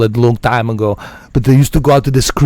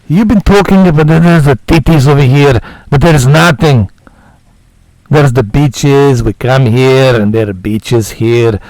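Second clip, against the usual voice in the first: Nico Bellic voice; 4 dB louder than usual. Pretend. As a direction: play a blunt immigrant hero who is fed up with the city. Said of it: "My Nico Bellic is going away".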